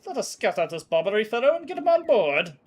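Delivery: rally the crew forward; eager command. Acted. Ship owner urging the crew on, eagerly. Voices character.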